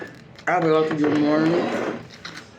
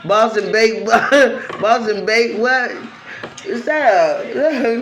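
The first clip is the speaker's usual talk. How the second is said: laughing; 6 semitones higher, 4 dB louder than usual. Real, not acted. Laughing, mocking the name.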